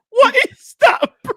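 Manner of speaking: laughing high through the words; can't get it out for laughing; laughing disbelief. Real, not acted. Co-host laughs at the worst corner replay.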